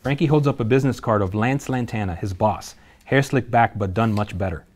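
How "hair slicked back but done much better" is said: usual speaking voice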